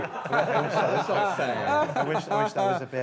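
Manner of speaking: giggling through the words; laughing. Real, not acted. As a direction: say it while cracking up. Laughing while talking.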